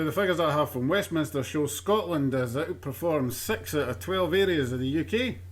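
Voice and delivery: ordinary talk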